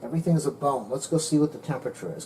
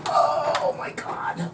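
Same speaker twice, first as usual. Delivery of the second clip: strained oh my God; 14 semitones higher, much slower than usual. Real, not acted. Pulling heavy stuck turkey out.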